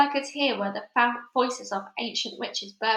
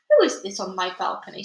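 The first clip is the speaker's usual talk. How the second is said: plain reading voice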